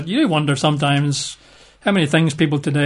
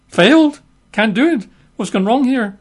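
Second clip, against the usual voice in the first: gasp, then a much higher panicky cry; mock panic; 8 semitones higher, 4 dB louder than usual. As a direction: lose it in mock alarm. Disciples panic at failure.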